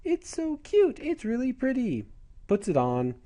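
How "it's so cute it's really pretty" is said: very high, squealy mock delight; gushing girl. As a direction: coo over a pretty gift, hammed-up. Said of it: "She goes".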